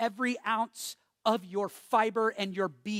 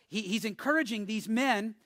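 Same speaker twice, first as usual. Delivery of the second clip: ordinary delivery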